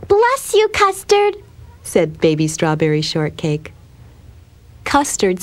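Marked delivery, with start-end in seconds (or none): 0.1-1.4 put-on very high, sweet child voice; little girl's bless-you. A narrator's reading. Strawberry to her sneezing kitten, very high little-girl voice. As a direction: pipe up high to a sneezing pet.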